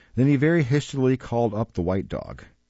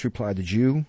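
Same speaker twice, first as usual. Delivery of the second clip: ordinary delivery, nothing marked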